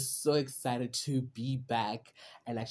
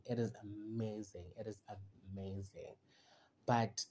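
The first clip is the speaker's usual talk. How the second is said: usual speaking voice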